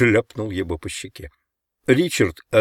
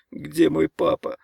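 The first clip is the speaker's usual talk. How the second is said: put-on plaintive little-boy pleading; pleading in sleep; 7 semitones higher than usual. A narrator's reading. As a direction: beg feebly, as if caught in a nightmare. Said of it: "бормотал Ричард во сне".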